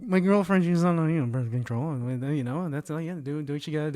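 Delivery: low, soft, trailing mumble; embarrassed minimizing. Real, not acted. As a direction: play it down under your breath. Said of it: "there's no way of you minimizing it".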